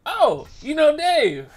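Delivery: high, loud, drawn-out naive surprise; mock your own oblivious delight; clueless dumbass. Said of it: "Like a fucking dumbass".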